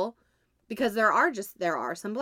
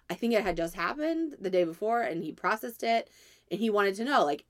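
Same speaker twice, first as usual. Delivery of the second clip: ordinary delivery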